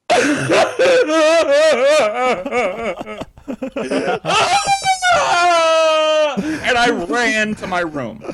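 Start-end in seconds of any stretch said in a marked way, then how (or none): 0.2-6.7 wailing shout. Retold or imitated. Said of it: "looked right at my sister ... and I said".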